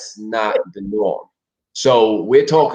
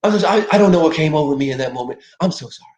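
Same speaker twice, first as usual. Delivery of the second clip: lightly higher pleading sorry; mock profuse apology; 3 semitones higher, faster than usual. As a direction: grovel over and over.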